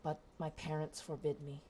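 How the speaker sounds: soft, wistful giantess; hushed confession. Acted. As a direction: confide it quietly. Giantess confides softly.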